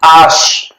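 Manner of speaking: "आश" repeated, no h sound; H as "आश" again, clear; French letter name. Shown as a demonstration. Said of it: "ह कहीं नहीं आएगी फ्रेंच में". (फ्रेंच "आश" फिर से)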